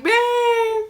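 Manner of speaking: high wailing babe, babe through tears; retold crying plea. Wife wailing for her sleeping husband, tearful. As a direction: wail his name like you're sobbing.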